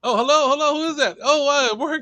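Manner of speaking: high, loud, flustered hello; real startled surprise. Real, not acted. Host caught off guard by a guest's voice, startled. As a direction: jump at the unexpected voice, pitch shooting up. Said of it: "you startled me".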